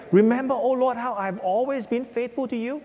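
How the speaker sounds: high, pleading prayer of a hurt servant; pleading Hezekiah. Acted. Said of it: "Remember we read it?".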